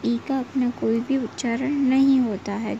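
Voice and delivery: ordinary talk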